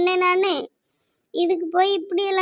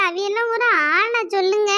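ordinary talk

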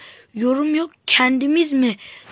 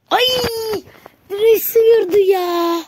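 anguished cry; 11 semitones higher, 6 dB louder than usual. Real, not acted. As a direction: cry out as the shot grazes the post. Shot hits the post.

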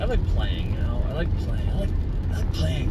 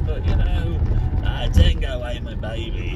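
ordinary delivery

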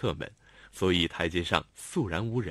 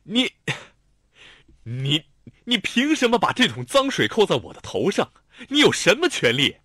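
stammer, then loud outrage; sputtering fury; 9 semitones higher, 8 dB louder than usual. A narrator's reading. Xiao Tong accuses Lanlan of smearing him, sputtering. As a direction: choke on the words in fury. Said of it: "气急败坏的几乎无法言语".

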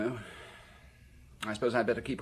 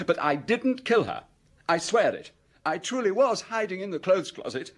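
pleading innocence; 9 semitones higher, 4 dB louder than usual. Acted.

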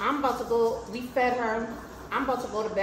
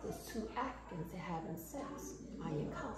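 very soft, low mock whisper; hushed confiding; 4 semitones lower, 15 dB quieter than usual. Granddaughter imitating grandma's hushed report. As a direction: murmur it low like a secret. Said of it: "she said".

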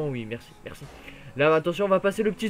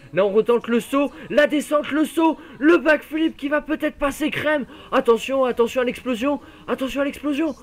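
excited commentary; 6 semitones higher, 4 dB louder than usual. Real, not acted. Hyped commentary of a jump.

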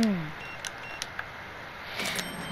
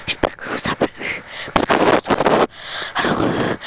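mock ASMR whisper. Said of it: "faut faire de l'A S M R".

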